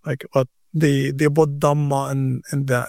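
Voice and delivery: ordinary delivery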